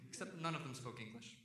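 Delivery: ordinary delivery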